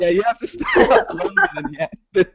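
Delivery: laughing through the words. Real, not acted. Joking to the host, laughing as she says it. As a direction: laughs it out mid-sentence. Joking about staying up late.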